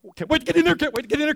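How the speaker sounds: high-pitched, giddy mock excitement; eager churchgoer. Churchgoer arriving for worship, bursting with eagerness. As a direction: gush it in an impatient, repeating rush.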